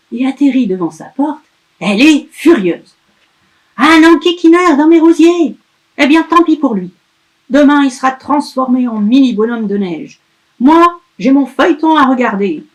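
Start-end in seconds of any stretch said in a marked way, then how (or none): 3.8-12.6 loud, high, grumbling witch; cursing witch. Acted. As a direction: snap it out, annoyed at the intruder. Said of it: "peste-t-elle".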